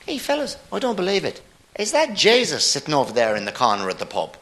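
high, animated Irishman voice; Irish pub regular spotting someone unbelievable; Irish accent. Acted. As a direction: play an astonished Irishman in a broad Irish accent. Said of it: "it comes with accents".

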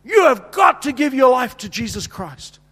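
shouted altar call, high and slow; loud, slow preaching call to get saved. Retold or imitated. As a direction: preach it out at full force to a crowd. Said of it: "I'm preaching to them".

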